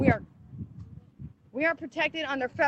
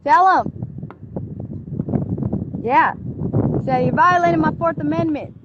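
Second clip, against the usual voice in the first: urging loudly; 6 semitones higher, 8 dB louder than usual. Real, not acted. Mother coaching her daughter through the car window, loud and urgent.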